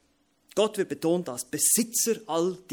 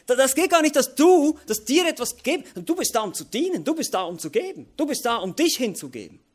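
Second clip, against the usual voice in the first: high, loud admonition; rebuking sermon; 10 semitones higher, 8 dB louder than usual. Acted. Preacher admonishing churchgoers who only want to receive, loud and high. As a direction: call the listeners to serve.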